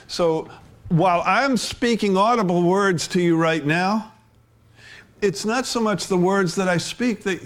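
fervent pulpit appeal; preaching. Acted. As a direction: press the point home to the hearers. Preaching with force.

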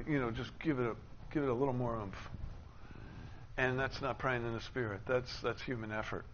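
ordinary talk, nothing marked

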